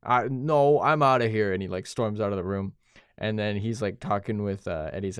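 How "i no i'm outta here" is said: retold outburst. Friend retelling how Eddie storms out, mock outburst. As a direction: act out storming off, raised voice.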